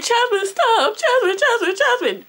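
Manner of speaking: very high, whiny imitation of a crybaby; mock crying plea. Storyteller mocking the crying girl begging her to stop, high whine. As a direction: mimic someone wailing a name to make it stop. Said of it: "Crybaby starts crying. She's like".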